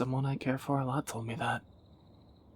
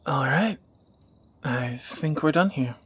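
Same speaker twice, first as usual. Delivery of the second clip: brisk wrap-up; 7 dB louder than usual. Acted. Wrapping up the shopping trip.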